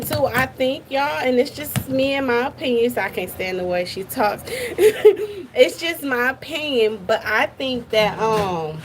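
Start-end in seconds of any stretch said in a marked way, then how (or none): 4.5-5.5 short high-pitched laugh. Real, not acted. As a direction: laugh briefly after a blunt admission. Laughs after admitting her dislike.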